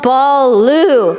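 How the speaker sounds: HULLABALOO drawn out syllable by syllable; slow, careful attempt. Shown as a demonstration. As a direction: say Hullabaloo slowly, each part held. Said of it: "try to say it slowly".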